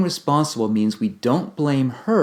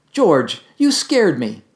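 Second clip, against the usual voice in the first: high, loud startled cry; 5 semitones higher, 6 dB louder, slower than usual. Shown as a demonstration. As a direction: jump at the scare. Acting out startled blame.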